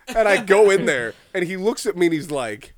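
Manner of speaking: normal talking voice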